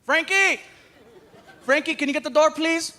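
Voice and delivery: high call through the house; dad calling Frankie from another room. Retold or imitated. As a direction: call the kid to get the door. Calling son to door.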